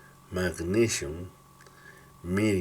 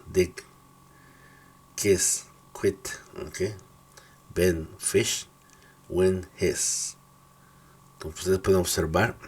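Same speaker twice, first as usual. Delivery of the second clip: ordinary talk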